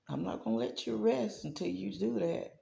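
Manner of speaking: soft but firm, barely above a murmur; hushed insistence. Retold or imitated. God insisting she post before she rests, hushed and firm. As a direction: press gently and don't let up.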